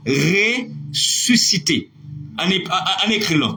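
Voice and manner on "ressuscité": "Ressuscité" drawn out very slowly with open vowels; open-mouth pronunciation. Shown as a demonstration. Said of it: "on prononce avec la bouche ouverte".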